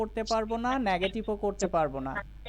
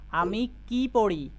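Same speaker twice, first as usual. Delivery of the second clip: slow, with কি leaned on hard; wh-question with a heavy, drawn-out কি; stress on কি; slower than usual. Shown as a demonstration. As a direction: stretch and punch the word কি. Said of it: "কি এর ওপরে জোর দিতে হবে".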